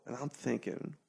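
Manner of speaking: ordinary talk, nothing marked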